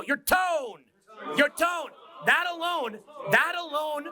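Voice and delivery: high, swooping last word; interesting tone. Shown as a demonstration. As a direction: throw "tone" and "alone" high, let them fall. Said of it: "Try just talking to someone and go".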